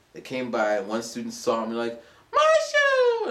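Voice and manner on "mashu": high, loud shout of the name; high loud excited call. Retold or imitated. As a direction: call out in delighted surprise. Student spots teacher, calls out.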